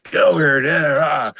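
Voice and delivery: hyped-up sideline yell, high and loud; rah-rah coach screaming. Retold or imitated. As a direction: bark it like an over-pumped coach. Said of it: "hyped up, screaming".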